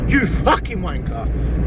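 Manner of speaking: slow, high, spat-out insult; mock road rage. Driver cursing a motorist who didn't say thanks, slow mock rage. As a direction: drag out the insult at the rude driver. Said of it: "I'm like".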